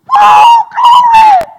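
very high, loud, long-drawn whoop; mimicked ecstatic daughter's yell; imitated jubilant holler. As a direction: stretch it into a hammed-up glory shout. Daughter rejoicing on the scales.

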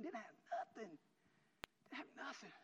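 small, overcome voice; hushed emotion. Real, not acted. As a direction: let it shrink to a tiny, high murmur. Moved, recalls having nothing.

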